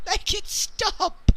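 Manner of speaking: slow, high mock wail; mock plea. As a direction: drag it out like a hammed-up plea. Mock despair about the topic.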